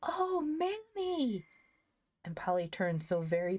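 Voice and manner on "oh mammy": high, drawn-out gasping cry; slow cry of shock and joy; overwhelmed cry. A narrator's reading. Polly overwhelmed seeing stove.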